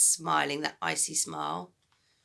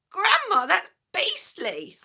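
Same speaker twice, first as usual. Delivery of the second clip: put-on high, slow, revolted boy; boy's disgust; 12 semitones higher, 6 dB louder than usual. George recoiling from Grandma's talk, high disgust. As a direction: recoil at the idea.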